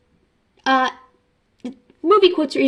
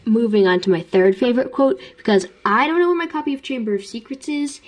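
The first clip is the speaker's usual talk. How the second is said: ordinary talk, nothing marked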